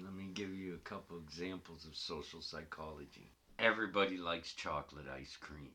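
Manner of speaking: deep lecturer voice. Acted. Mock lecture voice.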